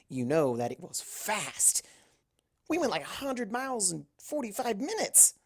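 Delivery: a cocky pegasus bragging to her friends; Rainbow Dash voice. Acted. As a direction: brag about speed like a cocky flyer.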